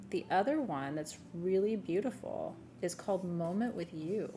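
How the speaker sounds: ordinary delivery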